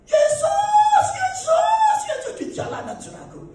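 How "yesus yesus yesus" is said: very high, loud cries for Jesus; imitated street screaming. People in the street during an earthquake, screaming. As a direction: cry out like panicked people in the street. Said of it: "Saya dengar orang teriak-teriak".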